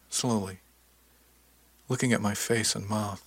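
usual speaking voice

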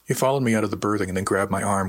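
plain reading voice

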